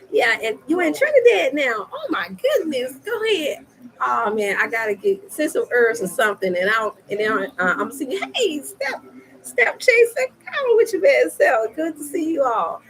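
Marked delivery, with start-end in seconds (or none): 0.1-3.7 high, thrilled exclamation; gushing, high-pitched amazement; delighted reaction. Real, not acted. Delighted by viewer now in Trinidad. 8.3-12.8 high, whooping hello; excited high-pitched shout-out; exuberant greeting. Real, not acted. Hailing another viewer joining live.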